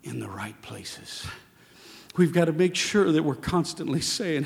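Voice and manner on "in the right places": slow whispered ending. Acted. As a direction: breathe it out. Whispered close.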